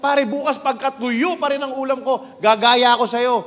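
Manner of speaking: fed-up worker griping at his buddies; exasperated high complaint. Acted. Worker two complaining about dried fish.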